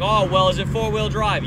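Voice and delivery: lightly voiced oblivious old man; retold old clerk. Old clerk asking the customer about the truck, loudly oblivious. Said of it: "he just kept not listening to me".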